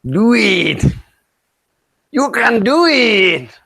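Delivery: drawn-out, high-pitched cheer; slow high cheering. Acted. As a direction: egg him on slowly, pitch up.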